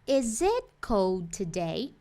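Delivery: "Is it cold today?" spelled out slowly, rising at the end; slow English teacher model; slow clear English. Shown as a demonstration. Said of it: "Eu falaria".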